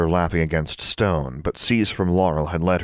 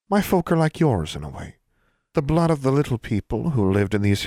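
higher, formal elfin voice; a prim little elf explaining his folk; proud elf; 4 semitones higher than usual. Acted. Elf explaining his kin to Alexandra, higher formal voice. Elf Seas from Laurel speaking.

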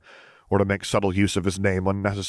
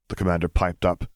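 plain reading voice

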